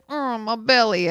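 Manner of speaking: mildly hammed-up bellyache groan; mock-pitiful moan; dramatic moaning. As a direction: imitate a husband milking his discomfort. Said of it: "kind of dramatically".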